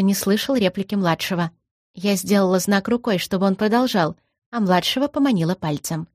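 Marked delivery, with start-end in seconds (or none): none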